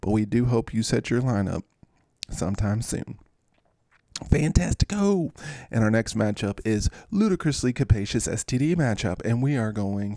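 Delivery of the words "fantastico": playful exclamation. Real, not acted.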